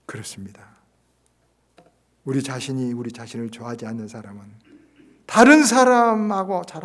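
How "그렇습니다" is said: soft unvoiced affirmation; whispered amen. Real, not acted. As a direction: let it land in a hush. Pastor affirms after pause.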